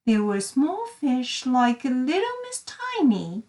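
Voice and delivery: ordinary delivery, nothing marked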